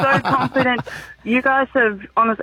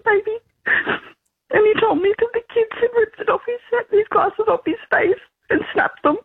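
high, tearful voice close to crying; emotional, near tears; 10 semitones higher than usual. Real, not acted. Mother retelling her son's bullying, voice high and tearful. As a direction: fight back tears while telling it. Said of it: "Sorry, guys, I'm getting so emotional".

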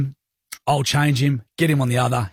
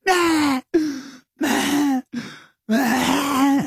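put-on much higher squeaky baby whimpers; imitating baby whimper; 14 semitones higher, much slower than usual. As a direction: bleat like a grizzling newborn. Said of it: "He's going".

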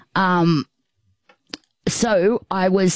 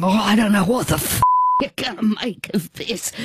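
laughing, going; 5 semitones higher, faster than usual. Retold or imitated. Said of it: "Sal's just laughing, going".

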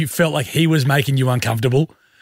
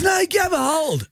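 high-pitched retold insistence; insisting brother. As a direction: push the baby on him, voice pitched way up. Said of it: "He goes".

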